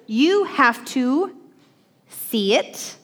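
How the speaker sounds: slow emphatic. Shown as a demonstration. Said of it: "teaching emphasis".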